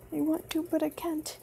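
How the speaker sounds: usual speaking voice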